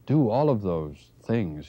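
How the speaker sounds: mild stammer. Acted. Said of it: "he stammered".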